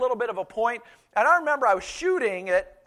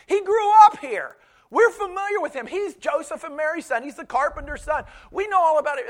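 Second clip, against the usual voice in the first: higher, louder indignant crowd voice; scoffing townsfolk; 7 semitones higher, 5 dB louder than usual. Retold or imitated. Hometown people huddling about Jesus, mildly mocking and indignant. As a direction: play the hometown gossip who won't be impressed.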